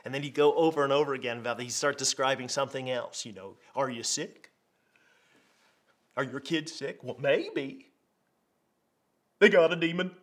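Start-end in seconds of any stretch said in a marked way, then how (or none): 3.7-10.1 mock Texas TV preacher; teasing Texas accent; Texas televangelist accent. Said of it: "I'm gonna do my Texas accent here".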